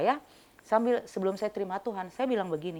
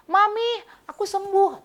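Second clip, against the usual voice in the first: put-on high, slow, happy child saying it; little child's voice; 8 dB louder than usual. Mother quoting her healed child in a high, slow child voice. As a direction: voice the small child joyfully.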